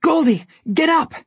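high, loud urgent shout; urgent yell. A narrator's reading. Jack yelling at Goldilocks to get up, high and urgent. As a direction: yell to get her moving. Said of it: "Jack yelled".

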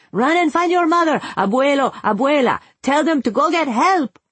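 high, loud, panicked orders; urgent yell. A narrator's reading. Father sending daughter running to the family, yelled. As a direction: keep yelling, pitched far up and pressing. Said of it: "he yelled".

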